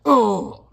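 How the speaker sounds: loud high grunt of pain; a comic hero winded by the wall; mock pained cry. Hellboy slamming into the wall, loud mock cry.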